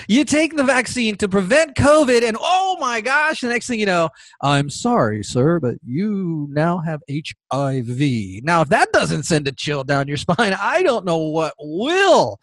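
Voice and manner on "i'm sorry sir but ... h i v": low, slow, solemn delivery; grave bad-news bearer. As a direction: break grave news slowly, in a lowered voice. Voices someone breaking grave news.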